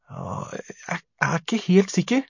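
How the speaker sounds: breath first, then high hesitant answer; unsure, nervous. A narrator's reading. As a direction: falter and restart, voice rising. Frightened boy answers monster unsurely.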